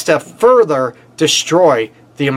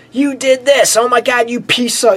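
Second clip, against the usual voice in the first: yelling accusation; 8 semitones higher than usual. Acted. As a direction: imitate a partner hurling blame. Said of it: "You start yelling and screaming".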